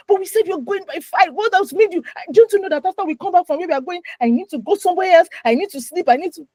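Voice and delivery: high, fast exasperated scolding; furious wife. Retold or imitated. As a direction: scold him for making you late. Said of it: "I'm already furious".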